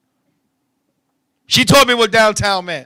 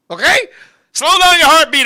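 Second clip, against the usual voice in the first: ordinary delivery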